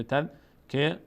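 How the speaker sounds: تذکره slowly, closing on -rah; pausal he ending. Shown as a demonstration. Teacher sums up the stopping rule, pausal h. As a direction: finish تذکره with h in place of te.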